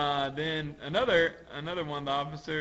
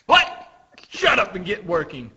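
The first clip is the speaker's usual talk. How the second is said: loud, high scolding; mock-furious taskmaster; angry overseer; 4 semitones higher, 8 dB louder than usual. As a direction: order the workers back with a yell. Acted overseer yelling at workers.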